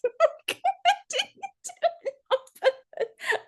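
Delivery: high, squeaky words through real laughter; laughing. Real, not acted. To the workshop about her husband, laughing helplessly. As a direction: protest while cracking up.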